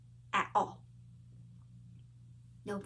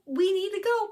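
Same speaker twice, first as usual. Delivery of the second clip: more urgent plea; 10 semitones higher than usual. Retold or imitated. Woman pressing her friend again to leave, higher and more urgent. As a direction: push harder, almost pleading. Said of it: "And I was like".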